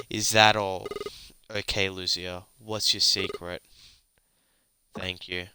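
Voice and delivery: ordinary talk, nothing marked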